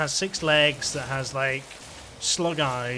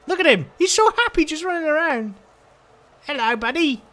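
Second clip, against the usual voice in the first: high, loud, gushing excitement; overjoyed squealing; 11 semitones higher, 5 dB louder than usual. Real, not acted. Player greets the creature running around, overjoyed squeal. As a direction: squeal it out, thrilled for the little creature. Thrilled watching happy creature run.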